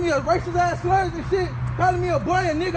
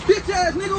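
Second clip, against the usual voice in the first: ordinary talk, nothing marked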